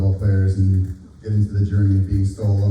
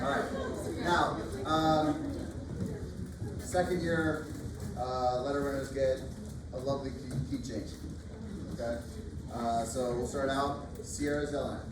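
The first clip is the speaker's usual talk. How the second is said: projected, higher announcing voice; teacher voice; 7 semitones higher, 12 dB quieter than usual. Real, not acted. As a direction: call the names out across the room. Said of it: "Can I use my teacher voice?".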